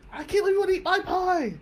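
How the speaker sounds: high-pitched, fast mock scream. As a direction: shriek it like a hysterical date. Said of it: "And she's screaming".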